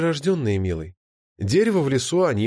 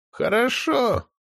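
put-on very old man; 9 semitones higher, much slower than usual. A narrator's reading. Old sorcerer answers.

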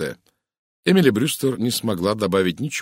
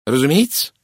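louder, higher, curt retort; snapping; 6 semitones higher, 6 dB louder than usual. A narrator's reading. Woman retorts to Poirot's doubt, snapping. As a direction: cut him off with one emphatic word. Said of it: "отрезала Эмили Брюстер".

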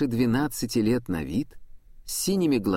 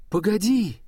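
calling out; 10 semitones higher, 4 dB louder than usual. Acted. Prudence calls after the girl.

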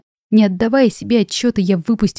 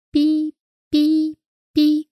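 high beeping bracelet noise; shrill beeping; 9 semitones higher, much slower than usual. Acted. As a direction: squeak it like an electronic alarm. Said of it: "так противно пищит". (противный писк)

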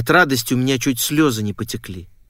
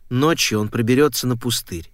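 usual speaking voice

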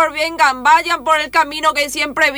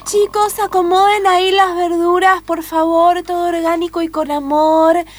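higher, sweet new-age voice; hippie commune leader; 6 semitones higher than usual. Acted. Commune leader welcoming lost children, in a high, sweet hippie voice. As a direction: coo at the kids like a hippie guru.

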